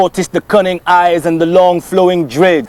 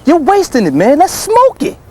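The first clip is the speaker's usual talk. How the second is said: much higher, indignant outcry; alarmed protest; 12 semitones higher than usual. Acted. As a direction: object in high-pitched disbelief. Protests at weed thrown as bait.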